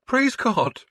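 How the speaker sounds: high, pinched, barely holding it; strained. A narrator's reading. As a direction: squeeze it out high. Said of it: "Stiorra managed to say".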